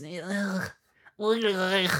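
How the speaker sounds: drawn-out throaty ugh; disgusted groan. Real, not acted. Fan fed up with the hype, disgusted groan.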